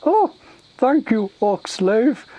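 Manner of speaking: high, loud grateful ogre voice; simple-minded ogre, grateful. Acted. Ogre thanking the orc for the warning, grateful. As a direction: gush thanks like a dim ogre.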